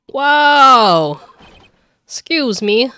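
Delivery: high, loud drawn-out exclamation; loud outburst. Acted. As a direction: cut in at full voice. Loud interruption in game.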